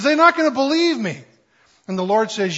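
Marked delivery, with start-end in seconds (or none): 0.0-1.2 much higher, louder, whiny protest; hammed-up despairing Moses; pleading Moses. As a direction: Moses begging off the task. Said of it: "He says".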